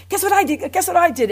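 calling a friend at midnight, giddy bragging. Retold or imitated. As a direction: crow it like a kid showing off. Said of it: "I wanna tell them".

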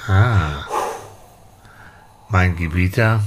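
ordinary talk

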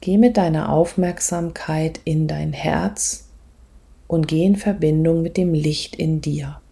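soft, calm meditation voice, often whispered; guided meditation. Acted. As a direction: guide the listener gently inward.

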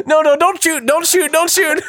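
high mock plea through laughter; panicked surrender shout. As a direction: beg them not to shoot, playfully.

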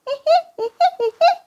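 high squeaking giggle; a tiny excited squeal; squeaky giggle. Acted. Ball squeaking voice.

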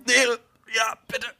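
strained high choking voice. Pretend. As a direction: gasp out the words. Spielt Erstickenden. (gespielt erstickte Stimme)

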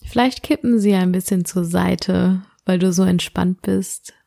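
sleep meditation. Acted. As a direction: guide the listener gently into sleep.

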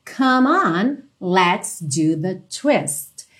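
"come on" joined at the M, drawn out slowly; slow model line, "come on" as one word; linked M. Shown as a demonstration. Teacher showing the M linked into "on", slow and clear. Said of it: "já liga direto esse M no on".

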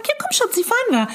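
put-on high-pitched sing-song invitation to a child; sweet motherly. Mother telling her little son they'll go, sweet and high.

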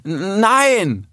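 high, stuttered cry of denial; stumble, then cry out the denial; caught-out yelp, pitched high; stammered shout. A narrator's reading. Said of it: "rief Rix".

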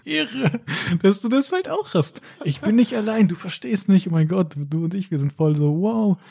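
high, giddy amazement with a laugh; gushing excitement. Pretend. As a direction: squeal with delight at finding a kindred spirit. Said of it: "dass man dieses".

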